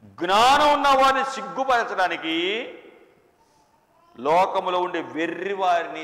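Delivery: loud, high, slow pulpit proclamation; fervent preacher's cry; preaching at full force. Acted. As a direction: thunder it out to the congregation.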